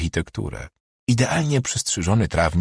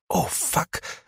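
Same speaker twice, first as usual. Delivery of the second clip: blurted out in awe; 11 semitones higher, 7 dB quieter than usual. A narrator's reading. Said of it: "wyrwało się Ewie na widok".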